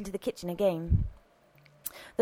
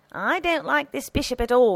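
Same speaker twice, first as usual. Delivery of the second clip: high-pitched, firm character voice; disgruntled housekeeper; 6 semitones higher, 4 dB louder than usual. A narrator's reading. Housekeeper returning with the cake knife, disgruntled. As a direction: insist on the dislike, pitched up. Housekeeper figure repeats her dislike.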